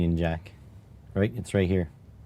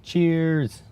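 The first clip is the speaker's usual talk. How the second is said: mock toast; 9 semitones higher than usual.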